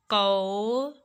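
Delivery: कौर slowly, "kauuu - ra"; exaggerated teaching demo of au in कौर; drawn-out vowel. Shown as a demonstration. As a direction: hold the "au" of कौर long before the r. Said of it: "औ का sound based".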